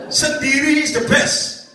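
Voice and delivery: slow sing-song tease; laughing, drawn-out joke; teasing punchline. Pretend. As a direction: drag it out playfully with a laugh. Joking punchline.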